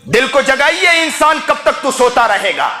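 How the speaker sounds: high, insistent sermon in driving repeated phrases; fervent preaching. Real, not acted. Preacher warns the heedless man of death, fervent preaching.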